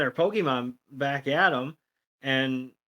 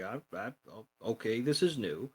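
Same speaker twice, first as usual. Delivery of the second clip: quiet, nervous stammer; a flustered Pikachu, voiced softer; 3 semitones lower, 8 dB quieter than usual. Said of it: "Pikachu's like".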